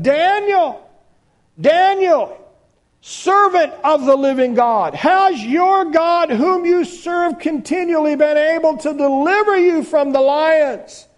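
high, loud pleading call to Daniel; lamenting cry. Acted. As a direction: cry out his name in anguish. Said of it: "he cried out to, in lamenting a voice".

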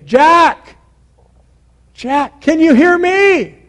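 high, loud call from afar. Acted. A voice calling down to Jack on the cliff, calling out. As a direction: stretch the words out to carry down. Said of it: "he heard a voice saying".

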